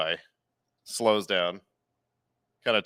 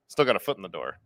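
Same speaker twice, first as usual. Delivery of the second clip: ordinary delivery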